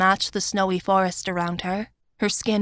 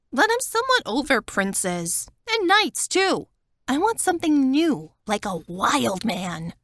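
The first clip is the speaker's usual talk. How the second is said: playful Wanda; 4 semitones higher than usual. A narrator's reading. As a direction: joke about wanting a wild man. Wanda joking about princes.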